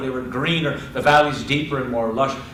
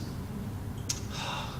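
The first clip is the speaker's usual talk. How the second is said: long, soft breathy sigh; resigned sigh; 15 dB quieter than usual. Acted. Wife giving in to her husband, acted resigned sigh. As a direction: let out a quiet sigh of giving in. Niamh gives in to Oisin.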